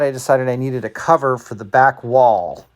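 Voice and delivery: normal talking voice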